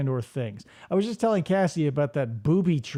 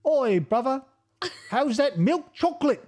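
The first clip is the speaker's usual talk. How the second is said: hammed-up British bloke, higher; a cheeky London geezer greeting his mate; overdone British accent with a rising "brother"; mock British accent; 5 semitones higher than usual. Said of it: "It said British, not Australian".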